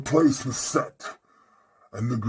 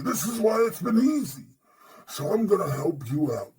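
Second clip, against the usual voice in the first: usual speaking voice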